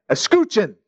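Escuchen lifted high with an exclamation point; teacher's exclamation. Retold or imitated. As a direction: snap it like a teacher calling out. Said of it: "she would say it with the exclamation point".

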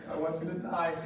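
sinister undertone. Retold or imitated. Smiling ticket taker to parents, mock sinister. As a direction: drop low under the friendly greeting.

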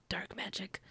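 hushed, spooky whisper on dark magic; a mock-ominous whisper. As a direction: breathe it like a secret. Spooky aside about Luke's magic.

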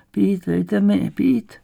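high, very slow, feeble plea; pleading old woman. A narrator's reading. Parched old woman begging visitors for water, slow pleading. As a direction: beg slowly as an old woman. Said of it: "prosila stará žena".

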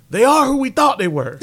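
mock press-conference meltdown; imitating Dennis Green. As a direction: yell it like a furious coach. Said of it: "second runner-up for best soundbite".

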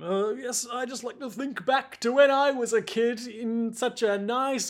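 put-on high, reminiscing old-man voice. Aged Narantia recalls his childhood garden, old man voice. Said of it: "like an old man would".

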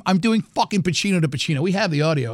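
ordinary talk

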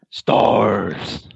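low, drawn-out monster growl of a name; Nemesis impression. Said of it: "give me their best Nemesis impression".

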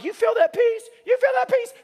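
very high, squeaky-pitched mock question; self-mocking high-pitched coaxing; coaxing the crowd. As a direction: ask it way up high, rising at the end. Mimicking himself coaxing the touched crowd.

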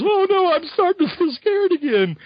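high hammed-up fear; mock scared. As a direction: whine it like a scared hero. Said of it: "Hawkeye goes".

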